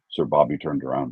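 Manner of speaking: ordinary delivery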